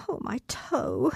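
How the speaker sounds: soft, slow, hurting moan; pained whimper. Acted. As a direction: moan about the toe under breath. Pained aside mid-speech.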